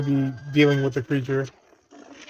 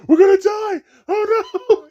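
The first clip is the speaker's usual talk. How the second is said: high shrieking mock panic; 5 dB louder than usual. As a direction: shriek it like a robot losing it. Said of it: "Or he forgot".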